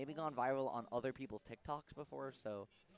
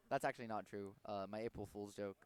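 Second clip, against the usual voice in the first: normal talking voice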